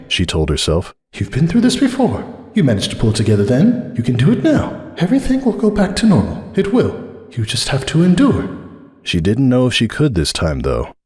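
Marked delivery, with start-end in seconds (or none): 1.1-8.5 high, trembling self-reassurance; Cupcake's high voice. A narrator's reading. Cupcake steadying herself before Carrick, high and strained. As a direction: talk herself down, voice pitched high.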